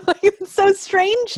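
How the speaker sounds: high, slow, laughing exclamation; amused astonishment. Real, not acted. Guest recalling her shock at the accents, laughing in high-pitched amazement.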